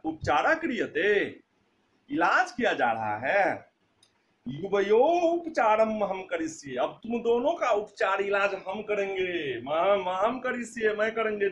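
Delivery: loud, menacing police officer; threatening inspector. Acted. Inspector threatens in-laws with treatment. (धमकाता हुआ दरोगा, ऊँची आवाज़ में)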